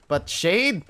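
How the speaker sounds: high, loud sassy drawl; playful diva outrage. Acted. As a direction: throw the shade back. Sassy mock offense.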